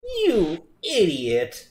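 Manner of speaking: slow, drawn-out taunt; gloating insult. Acted. Villain turning on the player, slow gloating. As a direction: stretch each word with scorn.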